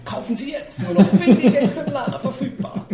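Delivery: high, laughing salesman pitch; chipper 1950s ad. Pretend. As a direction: hawk it like a TV shopping host.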